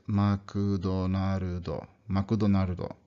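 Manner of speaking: "Makudonarudo" in even Japanese syllables, ma-ku-do-na-ru-do; katakana-style "Makudonarudo"; Japanese pronunciation. Shown as a demonstration. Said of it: "it sounds like".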